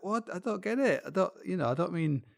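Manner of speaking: high-pitched, put-upon disbelief; acted-out baffled indignation; mock bewildered protest. Voices baffled accused climbers protesting.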